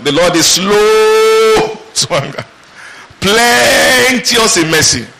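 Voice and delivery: slowed right down, each word savoured; slow meditation. Shown as a demonstration. Pastor meditating on the verse for the church, slow and drawn out. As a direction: linger over it like a meditation. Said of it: "I like to meditate".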